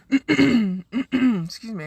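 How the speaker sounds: hoarse, losing her voice; strained hoarse voice after clearing throat. Real, not acted. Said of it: "I'm losing my voice".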